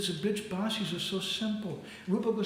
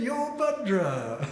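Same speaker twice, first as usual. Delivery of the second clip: loud, high, drawn-out call breaking into laughter; comic stretched-out hello; hailing greeting; 8 semitones higher, 5 dB louder, much slower than usual. Acted. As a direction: hail a buddy long and big, amused. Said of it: "So he's saying".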